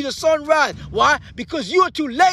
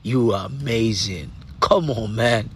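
low, soft, intimate voice over the music; hushed motivational talk; 4 dB quieter than usual. Acted. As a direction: lean in close and speak softly to one listener. Quiet intimate stretch over music.

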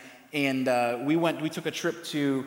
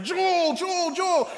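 screaming a name in pain; 13 semitones higher, much slower than usual. Retold or imitated. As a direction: call the brother's name in a panic, much higher. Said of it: "I started screaming my brother's name".

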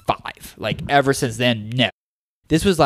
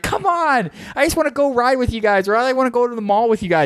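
put-on much higher, whining kid voice; little cousin begging to go to the mall, high whiny plea; 10 semitones higher than usual. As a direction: whine to be taken along. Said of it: "And they're like".

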